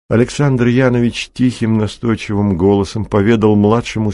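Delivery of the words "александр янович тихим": plain reading voice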